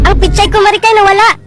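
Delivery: loud high cry of loss; panicked wail. Acted. Chuchay telling Maricar her pichay is lost, loud panic. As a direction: wail that it's gone.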